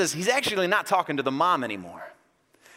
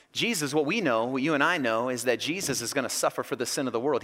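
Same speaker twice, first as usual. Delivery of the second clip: ordinary talk, nothing marked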